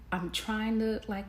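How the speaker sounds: usual speaking voice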